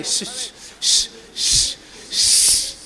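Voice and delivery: long, slow, soft shushing. Retold or imitated. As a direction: hiss them into silence, drawn out. Trying to silence noisy crowd.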